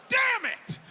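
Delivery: sudden high loud curse; frustrated outburst. Pretend. On the elevator being caught, a high loud curse.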